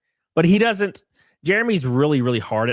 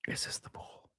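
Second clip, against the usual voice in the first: unvoiced whisper, much softer; 20 dB quieter than usual. Shown as a demonstration. Asking viewers in a secretive whisper to build suspense. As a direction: breathe the question in a whisper. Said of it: "whispered repeat".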